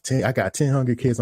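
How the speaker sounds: high-pitched mock complaint; imitating customer going off. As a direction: mimic an upset customer's high protest. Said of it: "going off".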